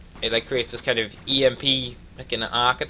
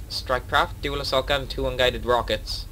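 usual speaking voice

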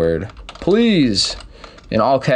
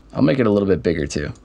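usual speaking voice

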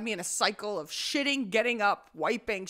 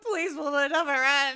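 loud, higher mock plea; retold scream; 6 semitones higher, 7 dB louder than usual. Friend heard screaming from the bathroom, hammed-up desperate plea. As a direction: wail it out, begging in jest. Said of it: "I hear Grace screaming in the background".

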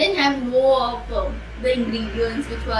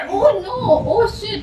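shocked; 7 semitones higher, 4 dB louder, slower than usual. Real, not acted. Discovers ghee mixed in drink.

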